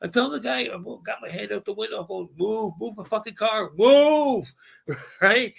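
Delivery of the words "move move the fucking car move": high yell building to a loud final word; road-rage holler, louder at the end; urgent retold yell. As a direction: re-enact hollering out a car window. Head out window yelling, retold.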